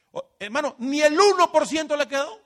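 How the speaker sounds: loud, high amazement; preacher shouting in awe; astonished cry. Real, not acted. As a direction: exclaim it in disbelief. Astonished sermon climax.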